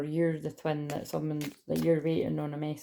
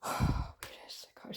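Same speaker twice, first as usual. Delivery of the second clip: huffing and puffing; 5 dB quieter, slower than usual. Real, not acted. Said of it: "I'm huffing and puffing".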